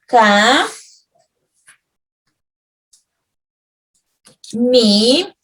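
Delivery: "ca" and "mi" held, with a long pause between; syllable by syllable. Shown as a demonstration. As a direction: sound out "ca", then "mi", each on its own. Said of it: "La palabra camisa tiene tres sílabas".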